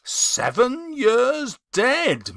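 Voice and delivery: thinking it over aloud; musing. A narrator's reading. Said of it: "mused Scrooge".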